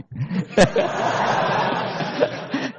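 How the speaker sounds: airy rising laugh; laughter after the punchline; breathy laugh. Real, not acted. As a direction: let out a high breathy laugh.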